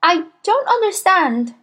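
put-on high little boy's voice; puzzled schoolboy. A narrator's reading. Boy baffled by the headmistress's order, puzzled. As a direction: plead confusion in a high child's voice.

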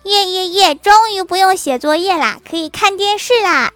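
put-on high, loud kid cheering; gleeful mock shout. Child cheering as homework is cancelled, gleeful shout. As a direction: whoop it like a child let off homework. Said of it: "高兴地大喊".

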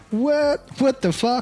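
high startled "What? What the fuck?"; shocked reaction. Retold or imitated. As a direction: play a baffled onlooker. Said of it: "让大家觉得". (惊讶反应)

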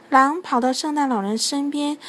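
ordinary delivery, nothing marked

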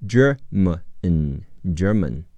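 ordinary delivery

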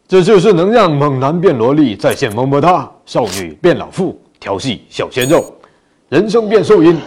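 loud, high infomercial hype; hyped ad pitch. Pretend. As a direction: sell it like a TV ad.

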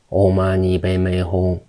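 一字一顿般平直地念诵六字真言. A narrator's reading. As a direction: chant the mantra on one level, unwavering note. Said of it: "口念". (平调念咒)

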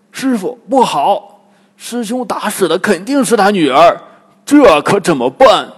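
high, loud cry of panic; alarmed. Acted. Bajie warns his master about the old woman, alarmed. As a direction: raise the alarm, rising to a loud helpless question. 八戒见老婆婆，惊慌求问.

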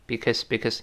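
"because" quick and soft with a weak schwa; passed over quickly. Shown as a demonstration. Teacher shows the reduced form, passed over quickly. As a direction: skim over "because" as bik-əs. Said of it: "一带而过".

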